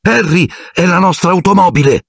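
high cry breaking with relief; calling out, flooded with relief; relieved shout. A narrator's reading. Ron calling to Harry when the light appears, high relieved cry. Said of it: "con la voce rotta dal sollievo".

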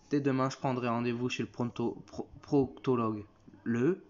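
ordinary talk

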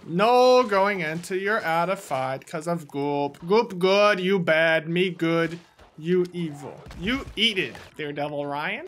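ordinary talk

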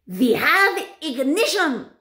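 high, loud, slow proclamation; triumphant witch shout. A narrator's reading. Grand High Witch ending her countdown, triumphant shout.

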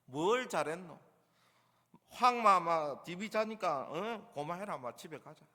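exasperated Gyeongsang telling-off, trailing away; fed-up Gyeongsang accent; Gyeongsang scolding. Acted. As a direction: dismiss them in blunt Gyeongsang dialect. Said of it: "경상도식으로 표현을 해도".

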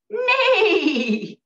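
loud, high whinny sliding down; whinny like a horse, loud and high; horse neigh. Shown as a demonstration. Teacher showing children what a horsey says, horse neigh. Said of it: "What sound does a horsey make?".

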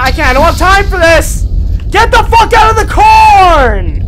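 loud, high, frantic yelling; real frantic screaming, held long; panicked shouting. Real, not acted. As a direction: shout at the monsters chasing you. Chased, panicking.

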